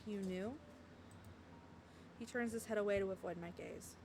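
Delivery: soft, hushed reading of game text; story narration. A narrator's reading. Streamer reading the game's story to viewers, soft narration. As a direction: read the story aloud softly, a little low.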